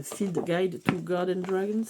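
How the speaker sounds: slow, careful English accent on the title; proud mock English accent; super English accent. Shown as a demonstration. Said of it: "j'ai fait un super accent anglais".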